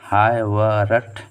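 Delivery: हाय वरट drawn out slowly, syllable by syllable; slow repeat. Shown as a demonstration. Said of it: "हाय वरट मान ले लीजिए". (हाय वरट को बहुत धीरे, एक-एक अक्षर बोलकर)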